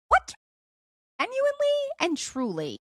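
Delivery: squeaked way up high; incredulous. Real, not acted. Mom exclaiming about the boys, high and incredulous. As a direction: exclaim it in disbelief. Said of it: "emphatic disbelief".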